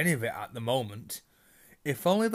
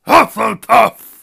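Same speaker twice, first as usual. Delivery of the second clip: huge, slow booming shout, much higher; hat shouts; 11 semitones higher, 21 dB louder, slower than usual. A narrator's reading. The Sorting Hat announces Hannah's house with a booming shout. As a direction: bellow the house name to the whole hall.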